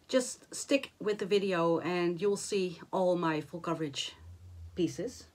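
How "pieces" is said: ordinary delivery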